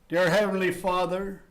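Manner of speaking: ordinary talk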